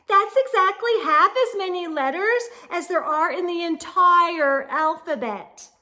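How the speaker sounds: loud, high, quick show-off recital; know-it-all girl. A narrator's reading. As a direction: rattle off the fact to impress. Know-it-all Victoria explaining.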